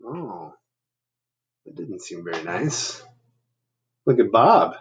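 ordinary delivery, nothing marked

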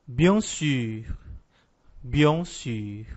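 bien sûr with nasal bien and pouted French u in sûr; French bien sûr, rounded u. Shown as a demonstration. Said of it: "E com certeza".

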